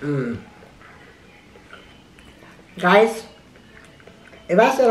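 talking between bites; chewing while talking. Real, not acted. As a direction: mouth partly full of food. Seen 5: Chewing with full cheeks after the bite; it fades by about 5 s.

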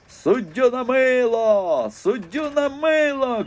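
high, slow drawn-out yell at the referee; furious yelling. A narrator's reading. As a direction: roar it till you burst. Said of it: "кричав, аж лопався дід Соломон". (дід несамовито кричить на суддю)

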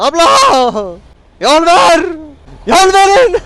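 loud high call to clear road; shouting. Real, not acted. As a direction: yell to make way. Calling out to driver in traffic.

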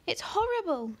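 put-on very high distressed girl; girl's anguished high cry. A narrator's reading. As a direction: wail it like a heartbroken girl. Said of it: "she cried".

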